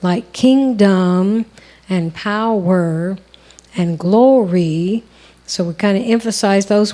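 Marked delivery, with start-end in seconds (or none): 0.3-5.0 slow, with kingdom, power and glory each pushed onto the second syllable; sung-lyric stress on the end of each word. Shown as a demonstration. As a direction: lean on the back half of kingdom, power and glory, slowly. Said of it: "accents on the second syllables".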